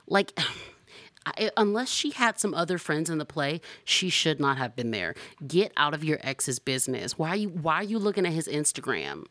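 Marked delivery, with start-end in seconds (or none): none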